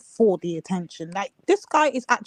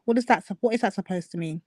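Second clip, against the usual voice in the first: normal talking voice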